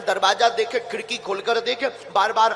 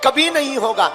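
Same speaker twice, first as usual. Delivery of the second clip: impassioned questions thrown out to a congregation; emphatic, ringing sermon delivery; rousing preaching; 3 semitones higher, 5 dB louder than usual. Real, not acted. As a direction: press the audience for an answer, voice raised. Preacher puts rhetorical questions to the audience.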